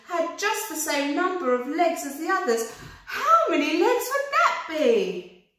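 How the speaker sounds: rising, building poem recital; excited rabbit reciting. Acted. Rabbit quizzing the centipede, rising excitedly.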